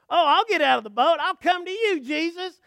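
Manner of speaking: high, loud, impetuous call; eager shout. Acted. Peter calling to Jesus from the boat, eager shout. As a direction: call out boldly, bursting with eagerness.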